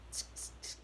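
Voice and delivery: soft unvoiced tsk tsk tsk; a quiet, mocking tut-tut; tongue clicks. Rat clicks tongue at confession.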